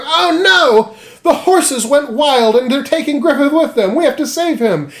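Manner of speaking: high, loud cartoon alarm; mock panic. The band seeing runaway horses, in hammed-up panic. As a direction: wail it in exaggerated panic.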